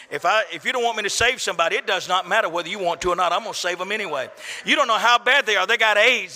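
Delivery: ordinary delivery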